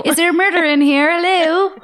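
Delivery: high, loud call through a door; calling into a house. Pretend. Mock neighbour calling into a house, loud and high. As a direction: call out to whoever might be inside.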